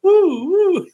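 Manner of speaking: high loud whooping woo; woo sound effect. Shown as a demonstration. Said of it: "I'll just do it myself".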